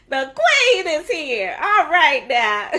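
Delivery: loud, high and slow, laughter spilling in; gleeful proclaiming. Real, not acted. Host hailing her old principal, gleeful. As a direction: draw out the announcement with a laugh.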